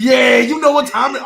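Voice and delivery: loud, hyped-up shout. Retold or imitated. Friends reenacting Roman's victory taunt, a loud hyped shout. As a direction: yell it like a champion celebrating.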